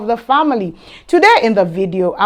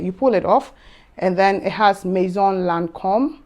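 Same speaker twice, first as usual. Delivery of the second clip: ordinary delivery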